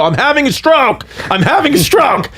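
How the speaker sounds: high-pitched retold yell at normal volume; mock panicked yell. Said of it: "I'm yelling that I'm having a stroke".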